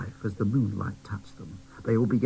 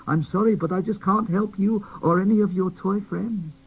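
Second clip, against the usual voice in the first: put-on higher, louder, pleading old man; sorrowful old toymaker; 9 semitones higher, 5 dB louder than usual. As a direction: break the bad news gently but urgently. Smithy tells doll grim news.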